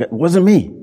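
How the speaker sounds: high-pitched mock denial; mock-defensive, voice jumping up. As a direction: imitate someone protesting their innocence. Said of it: "there's a defensiveness".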